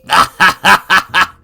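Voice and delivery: loud, high scornful cackle; old woman cackle. Acted. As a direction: burst into a crone's cackle. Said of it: "then started chuckling".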